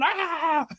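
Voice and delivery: put-on a kid yelping at a fan; child's excited squeal. Son sees the fan and lets out a high yelp. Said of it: "my son is like going".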